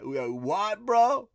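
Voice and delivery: loud, pitch leaping up on bro; mock outraged yo-bro retort; incredulous challenge. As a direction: throw it out as an affronted challenge. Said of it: "Like that".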